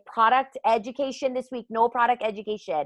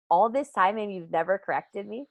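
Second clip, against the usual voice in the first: ordinary delivery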